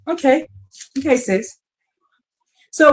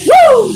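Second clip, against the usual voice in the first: very high, loud joyful whoop; excited woo; 11 semitones higher, 6 dB louder than usual. Real, not acted. Preacher praising Yah, a loud high joyful whoop.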